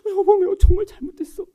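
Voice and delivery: mean every word of "내가 정말 잘못했어"; sincere apology. Shown as a demonstration. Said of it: "그 다음에 진짜로". (진심 어린 사과)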